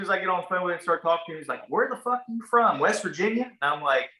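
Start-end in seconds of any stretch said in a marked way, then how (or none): 1.7-3.5 mock disbelief, pitched well up; retold incredulous question. Agent asks where he's from, mock incredulous. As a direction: throw it high, imitating a baffled agent.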